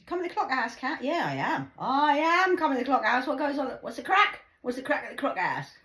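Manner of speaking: high, loud pub banter voice; loud cheery local. Retold or imitated. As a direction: shout it like a cheerful regular. Acted bar greeting, loud high.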